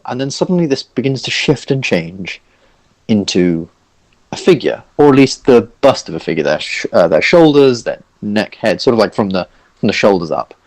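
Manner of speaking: normal talking voice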